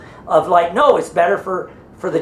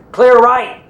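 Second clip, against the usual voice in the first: loud, higher "clear" check; retold call-out; 6 semitones higher, 7 dB louder than usual. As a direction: call it out to the team. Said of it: "the one goes".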